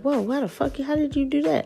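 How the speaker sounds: high disbelieving gasp of a question; mock amazement. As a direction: imitate stunned people asking how. People reacting in amazement.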